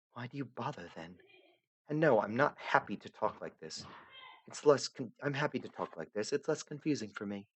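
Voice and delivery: lightly voiced young heroine, gently insistent; Aradia, softly puzzled. Aradia lost in the void, pleading softly to the narrator.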